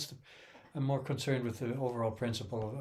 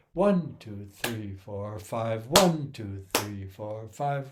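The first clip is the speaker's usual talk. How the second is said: each one louder; counting with accented ones; 2 semitones lower, 5 dB louder, slower than usual. Shown as a demonstration. Teacher counts fives, stressing each one. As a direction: punch every one in the count. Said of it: "if we're counting fives".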